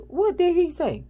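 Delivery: patient confronting the front desk, heated; demanding. Retold or imitated. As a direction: demand it like you're about to explode. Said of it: "And I'm like".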